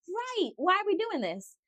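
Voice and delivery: high, softened pleading; mock exasperation. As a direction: plead in a play-acted high voice. Joins the acted argument.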